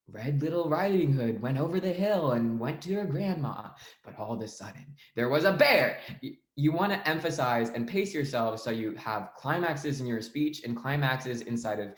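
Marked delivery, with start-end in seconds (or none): 0.1-6.0 engaging storytelling. Shown as a demonstration. Coach showing debaters how to captivate an audience, animated storytelling. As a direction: swoop through the tale, then hit BEAR hard. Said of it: "reading it like you want the audience to be as engaged as possible".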